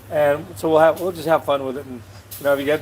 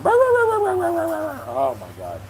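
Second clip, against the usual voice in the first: drawn-out, high-pitched exasperation; a long, high, fed-up groan of the words; exasperated oh my God; 14 semitones higher, much slower than usual. Real, not acted. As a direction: stretch it way up in disbelief. Exasperated at noisy barking dog.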